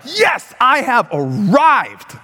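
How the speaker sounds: high, drawn-out boast; mock-grand, high and slow; triumphant arrival. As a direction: savour it like a grand entrance. Imagined triumph at a grand invitation.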